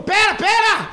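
frantic loud plea; urgent shout. Retold or imitated. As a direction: yell it mid-brawl. Friend yelling during the fight.

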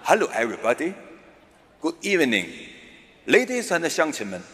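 mock-formal English speech opening; English speechmaker. Englishman in a suit greeting his audience, lightly mock-formal. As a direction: lightly play an Englishman addressing a hall. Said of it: "英国人穿着西服".

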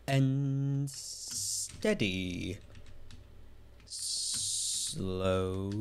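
very slow, stretched "Slow" and "steady"; drawn-out words. Real, not acted. Streamer coaching himself through a careful jump, drawn out and slow. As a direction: stretch each word as you creep forward. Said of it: "I'm just gonna go slow".

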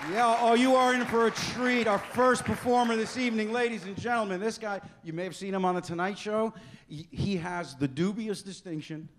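loud, high showbiz announcer voice; emcee introduction. Acted. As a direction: build to a big shouted welcome. MC introduces next comic.

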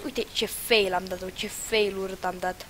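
ordinary talk

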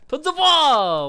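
drawn-out, very high shout; battle roar. Real, not acted. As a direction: bellow it slowly at the teammate. Urging teammate mid-game.

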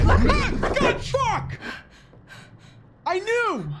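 startled yelp into a curse; sudden frightened outburst; scared horror game streamer. Real, not acted.

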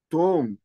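"tonk" said as one clear syllable; Coptic pronunciation. Shown as a demonstration. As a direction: model "tonk" clearly for the class. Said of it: "take into consideration my pronunciation". ("tonk" منطوقة بوضوح)

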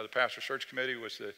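normal talking voice

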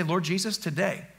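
hushed demo prayer to the Lord; quiet prayer. Shown as a demonstration. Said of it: "So this, say".

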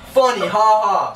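mock the laugh with dead 'ha ha'; heavy sarcastic jeer; mocking fake laugh.

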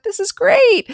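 high and loud, delighted; thrilled friend calling from the water; gleeful. Retold or imitated. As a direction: squeal with delight. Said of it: "And she went".

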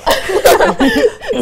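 high, loud cackle at own slip; loud burst of laughter. Real, not acted. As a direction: crack up after nearly swearing. Nearly swore on air.

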